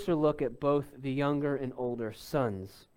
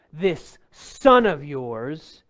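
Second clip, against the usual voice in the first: slow and louder, spitting out each word; angry disdain; 6 dB louder, much slower than usual. Retold or imitated. Older son disowning his brother to the father, slow scorn. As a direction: drag out the scorn in "son of yours". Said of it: "you can feel the anger and the disdain".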